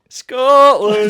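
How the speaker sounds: huge high roaring yell of Scotland. Pretend. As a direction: bellow it like a crazed fan. Mocking Scots yelling Scotland.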